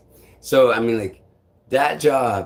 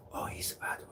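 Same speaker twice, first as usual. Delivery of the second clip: unvoiced eerie whisper; whispering voice in my head; 18 dB quieter, faster than usual. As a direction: whisper it like a voice inside your head. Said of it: "And then it says".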